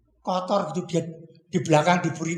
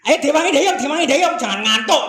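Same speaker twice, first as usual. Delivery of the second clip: urging call; 5 semitones higher, 5 dB louder than usual. Acted. Urging rowers to keep paddling.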